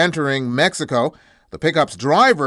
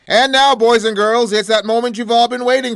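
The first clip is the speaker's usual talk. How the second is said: announcer hype; 7 semitones higher, 3 dB louder than usual. Acted. Host announces next segment.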